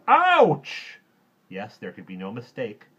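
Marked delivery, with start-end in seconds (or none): 0.1-1.0 very high, loud yelp of pain; shrieked, falling OUCH; cried ouch. A narrator's reading. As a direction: yelp as if jabbed by barbed wire. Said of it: "he stooped down and said".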